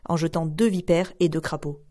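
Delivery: plain reading voice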